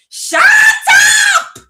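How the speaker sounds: mock top-of-the-lungs scream, very high; imitated scream. Imitating East African father losing his temper, high screamed yell. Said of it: "he would scream at the top of his lungs".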